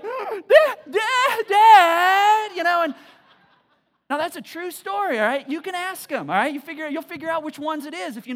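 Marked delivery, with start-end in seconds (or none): 0.0-2.5 loud, high shouts of Dad; frantic kids. Retold or imitated. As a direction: cry out in panic for Dad. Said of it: "they're like".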